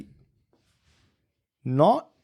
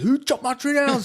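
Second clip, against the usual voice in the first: higher-pitched mock outrage of the owner; angry owner. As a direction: play the upset neighbour asking, much higher. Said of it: "The owner come in and goes".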